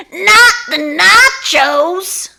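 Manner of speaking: high, loud, slow half-laughing wail; dismayed wail. Acted. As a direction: wail it in comic despair. Lunch lady watching nachos ruined.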